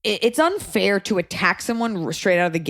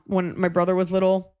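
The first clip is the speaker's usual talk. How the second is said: normal talking voice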